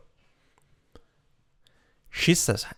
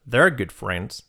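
ordinary talk, nothing marked

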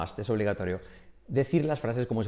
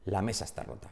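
"La mesa está rota" linked as one quick, soft word; words run together; 4 semitones lower, 8 dB quieter than usual. Shown as a demonstration. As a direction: glide through "La mesa está rota" with no gaps. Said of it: "sino dicen".

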